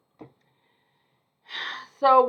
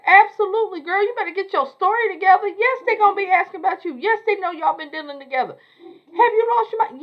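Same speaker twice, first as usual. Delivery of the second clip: a much higher, louder, near-crying friend's voice; high-pitched alarmed Fatima; 10 semitones higher, 5 dB louder than usual. Retold or imitated. Retelling the show, friend panics at Andy: high alarmed voice. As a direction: play her panicked, almost in tears.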